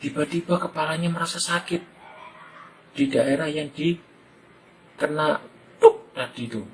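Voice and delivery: ordinary talk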